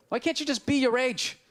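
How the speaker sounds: ordinary talk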